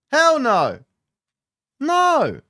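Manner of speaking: high, loud "hell no" shout; emphatic denial. Real, not acted. Loudly rejecting being sad.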